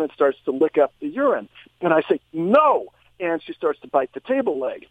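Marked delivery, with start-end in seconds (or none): 2.3-2.9 stern no. Retold or imitated. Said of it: "sternly say".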